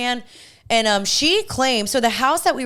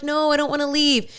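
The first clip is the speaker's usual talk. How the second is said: reluctant protest; 6 semitones higher than usual. Retold or imitated. Retelling her refusal to leave.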